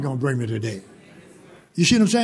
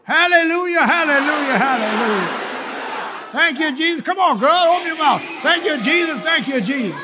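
high, ringing shouts of praise; exultant praise; 9 semitones higher, 3 dB louder than usual. Real, not acted. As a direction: cry out thanks with the congregation.

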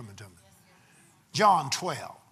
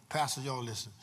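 ordinary talk

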